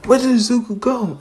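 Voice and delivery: high voice of a puzzled onlooker; confused crowd. Retold or imitated. Race crowd wonders where Zuko went, high and puzzled.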